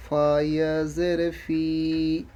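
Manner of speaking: ফি drawn out on a steady long i; one-alif elongation. Shown as a demonstration. Teacher explaining ya sakin after zer, stretched final vowel. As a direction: spell it out, then hold the long i of ফি. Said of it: "এক আলিফ টান দিয়ে পড়তে হচ্ছে".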